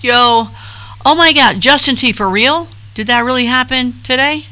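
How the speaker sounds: high, loud astonished reaction; shocked disbelief. Acted. Reacting to news from a viewer in chat, shocked. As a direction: react like you can't believe the news.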